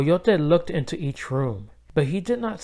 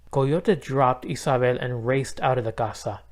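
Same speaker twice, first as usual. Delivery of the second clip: ordinary delivery, nothing marked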